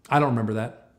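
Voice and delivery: plain, unruffled reply after a long pause; retold undisturbed denial. Employee answering an angry boss, undisturbed. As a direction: answer as if unbothered by the scolding.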